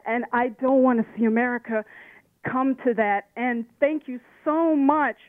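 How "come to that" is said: ordinary talk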